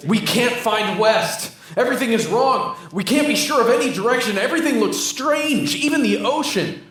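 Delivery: lost and frightened, pleading; panicked. Acted. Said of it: "this new voice is even more panicked".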